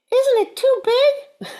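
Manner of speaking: very high, slow, loud squeak; squeaky bird. Acted. Bird voice asking.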